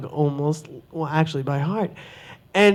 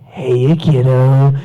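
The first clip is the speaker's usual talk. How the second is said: low, slow villain drawl; slow sinister clown voice; creepy Joker greeting; 6 semitones lower, 4 dB louder, slower than usual. Retold or imitated. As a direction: sneak up and purr it like a creepy clown. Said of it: "and I went".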